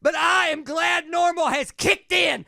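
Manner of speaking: fiery preacher. Retold or imitated. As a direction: preach it at the top of your lungs. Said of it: "crazy sermon".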